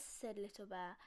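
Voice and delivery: ordinary delivery, nothing marked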